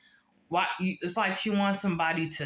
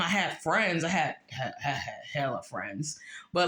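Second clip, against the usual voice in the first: usual speaking voice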